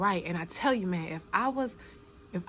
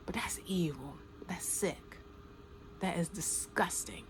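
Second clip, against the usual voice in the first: whisper; 9 dB quieter than usual. Real, not acted. To viewers about abusers, a disgusted whisper. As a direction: hiss it under your breath.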